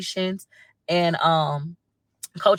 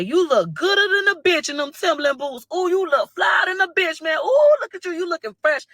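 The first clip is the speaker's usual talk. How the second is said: high, whooping crowd praise; hyped-up admiration; 11 semitones higher, 3 dB louder than usual. Retold or imitated. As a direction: gush like a crowd hyping someone up. Acts out crowd hyping him up.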